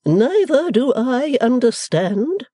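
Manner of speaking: slow, high, lofty scorn; scornful matron. Acted. Mother retorts to daughter, slow lofty scorn. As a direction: look down on her daughter. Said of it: "retorted Missus Wilfer with deep scorn".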